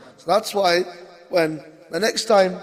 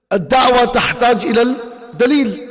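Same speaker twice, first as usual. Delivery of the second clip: raised and fast, Arabic rule declaimed with emphasis; stressed Arabic maxim; 5 semitones higher, much faster than usual. Shown as a demonstration. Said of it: "The claimant has to produce the evidence".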